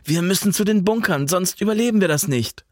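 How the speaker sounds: high, pressing plea at moderate volume; urgent shout. A narrator's reading. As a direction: cry out a desperate warning, volume held back. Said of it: "schrie Jan".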